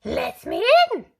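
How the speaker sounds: high loud put-on girl voice; wolf pretending to be Little Red; high fake girlish voice; fake squeak. A narrator's reading. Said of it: "squeaked the wolf".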